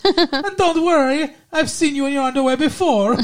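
high-pitched proud Italian mom; Italian mother voice. Retold or imitated. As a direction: gush like a doting Italian mother. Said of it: "she's Italian".

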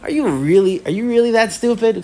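mock incredulous scolding. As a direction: act out teasing exasperation at a student.

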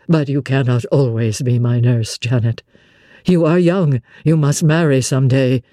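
brood, then press her gently; gloomy Rochester. Acted. Narrator voices Rochester lower.

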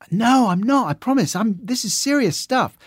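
high, pleading mock protest; defensive protest. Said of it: "Said one tutor".

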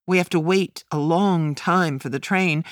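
usual speaking voice

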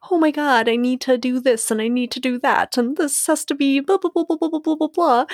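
high, rushed, frazzled rambling; frantic mock panic. Mocking herself running around stressed. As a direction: mimic your own stressed busy self. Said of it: "you're here running around like".

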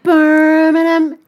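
high, loud, very slow Brummie; Birmingham accent. Shown as a demonstration. Host overdoes Birmingham, drawn-out Brummie accent. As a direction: stretch it out in exaggerated Brummie. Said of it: "I'm from Birmingham".

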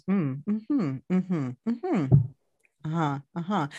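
gentle murmured mm-hmms and uh-huhs; soft huddle sounds. Shown as a demonstration. Therapist demonstrating huddle sounds, murmuring softly. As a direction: hum your agreement softly, like you're right there with them. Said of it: "the huddle are those sounds".

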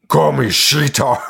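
put-on monster-movie villain voice; Jagenberg. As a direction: imitate a demon-toothed creature announcing her name. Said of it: "In the, in fucking Jagenberg voice".

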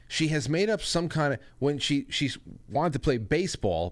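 ordinary talk

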